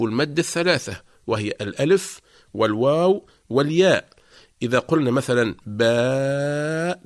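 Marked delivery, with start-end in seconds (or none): 5.7-7.0 "باء" as a long, sustained "baaa"; long madd vowel. Shown as a demonstration. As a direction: keep the a of "باء" ringing without closure. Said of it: "المد لا يتوقف". (نطق "باء" بمد طويل متصل للألف)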